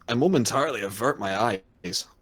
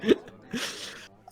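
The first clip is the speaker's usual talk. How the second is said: words breaking into a laugh; 11 semitones higher, much slower than usual. Real, not acted. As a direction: laugh through the line. Laughing mid-line while reading.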